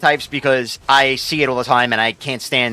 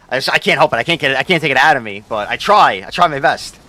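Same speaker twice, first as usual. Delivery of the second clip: usual speaking voice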